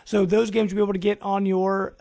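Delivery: ordinary delivery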